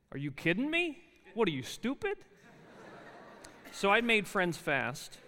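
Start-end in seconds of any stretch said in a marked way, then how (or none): 0.1-2.2 retold snap, pitched well up; rude retort. New student sneering back at a girl's question, mock rude. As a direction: fire it back like a brash Jersey kid.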